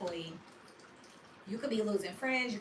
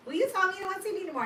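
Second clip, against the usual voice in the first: fast, higher indignant comeback; mock hurt retort; 10 semitones higher, 5 dB louder, much faster than usual. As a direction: rattle it off fast and high like a sulking girlfriend. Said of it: "And I was like".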